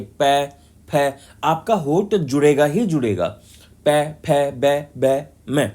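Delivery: each syllable spoken apart with both lips closing; lip sounds demo. Shown as a demonstration. Teacher asks class to try the lip sounds, clearly sounded. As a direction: let पै and बै pop off closed lips. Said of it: "अब आप बोल के देखिए".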